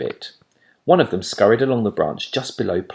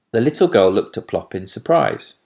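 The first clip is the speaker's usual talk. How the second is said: plain reading voice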